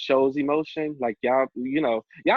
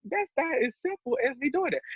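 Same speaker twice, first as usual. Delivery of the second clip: way higher, flustered and squeaky; 13 semitones higher than usual. Retold or imitated. Son dodging his mother's question, flustered high squeak. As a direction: squeak it out like you're cornered. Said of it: "I was like".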